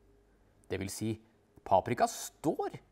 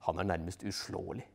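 plain reading voice

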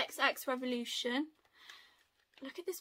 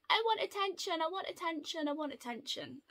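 cat's whiny voice; 5 semitones higher, 4 dB quieter, faster than usual. Retold or imitated. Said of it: "her going".

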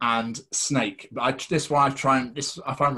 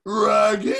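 much higher, louder cartoon dog voice; Scooby-Doo; 10 semitones higher, 8 dB louder than usual. Recalling his co-host's Scooby-Doo, a high, loud cartoon dog. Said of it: "you've done that on a Scooby-Doo".

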